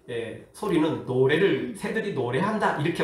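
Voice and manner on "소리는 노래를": ordinary talk, nothing marked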